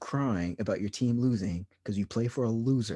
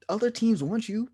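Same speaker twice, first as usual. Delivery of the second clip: mocking rant; 11 semitones higher, 4 dB louder than usual. Mad rant quote to Beal.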